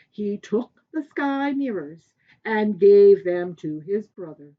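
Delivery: ordinary delivery, nothing marked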